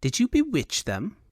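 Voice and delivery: high-pitched, excitable foppish voice; high and animated Elvunian dandy; high Elvunian fop. A narrator's reading. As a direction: play a foolish fop in a high, affected register. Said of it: "he said in a high Elvunian accent".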